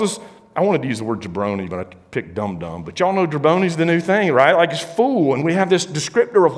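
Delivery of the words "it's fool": usual speaking voice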